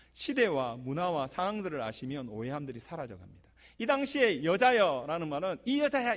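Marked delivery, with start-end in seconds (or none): 5.6-6.1 "이 여자야" tossed out higher and curt, like scolding a woman; rude "이 여자야". Shown as a demonstration. Pastor shows the wrong, rude reading, mock scolding. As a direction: say "이 여자야" as a dismissive insult. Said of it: "이렇게 할 때 이 여자야 아닙니다".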